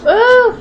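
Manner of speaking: high, loud "ooh"; sudden high ooh at a pet; loud, lifted ooh; surprised ooh. Real, not acted. Reacting to the bird.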